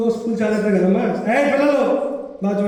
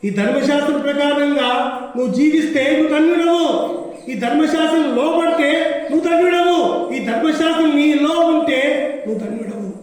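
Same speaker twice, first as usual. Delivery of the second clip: raised, ringing preacher's declaration; preaching at full force; 5 semitones higher than usual. Acted. Preacher declaring blessing to the congregation, fervent and raised. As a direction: proclaim it to the congregation with fervour.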